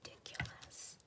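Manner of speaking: whisper. Real, not acted. Whispering a word while crafting.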